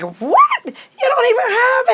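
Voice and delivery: high, loud fast outburst; shocked shout. Retold or imitated. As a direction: yell it in astonishment. Dad reacts to purchase.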